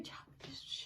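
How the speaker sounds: put-on urgent whisper to hush a child; whispered shush. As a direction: hiss it under your breath. Mom shushing daughter.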